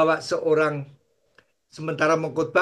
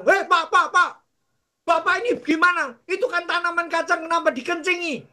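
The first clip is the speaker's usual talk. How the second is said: higher, indignant landowner; mock scolding; 11 semitones higher than usual. Landowner catches the pastor peeing on his peanuts, mock scolding. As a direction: tell him off, half-amused.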